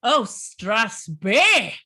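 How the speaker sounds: hammed-up Scottish accent; playful Scottish accent. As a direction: roll it out slowly in a Scottish accent. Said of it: "I'm getting my accent".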